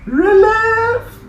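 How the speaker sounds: very high loud command; calling out. Acted. Teacher calls the step across the studio. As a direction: sing out the step.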